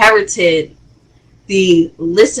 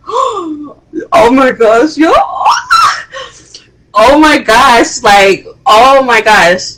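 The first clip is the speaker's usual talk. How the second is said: gasping, slow, louder disbelief; shocked; 5 semitones higher, 4 dB louder, slower than usual. Retold or imitated. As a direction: relive the moment of shock. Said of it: "I was in shock".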